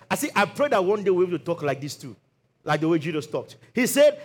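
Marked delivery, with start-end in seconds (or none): none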